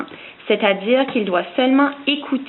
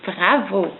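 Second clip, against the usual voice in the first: ordinary delivery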